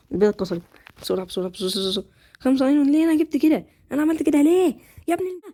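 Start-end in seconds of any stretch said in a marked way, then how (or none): 2.4-5.4 higher, louder exasperated outburst; frustrated shout. Real, not acted. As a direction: yell at your own mistake. Fumbles during the fight. (صرخة غيظ عالية)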